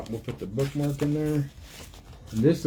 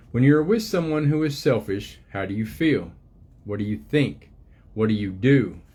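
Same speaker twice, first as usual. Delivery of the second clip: gentle storybook reading voice, unhurried; reading to children; 3 dB louder than usual. Acted. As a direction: read it like a picture book to little ones. Reads book aloud to children.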